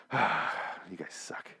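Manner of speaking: slow, soft, sulky mutter after a sigh; upset mumble. Retold or imitated. Victor leaves the table upset, slow sulky mumble. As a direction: drag it out dejectedly. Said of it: "walks out all, like, upset".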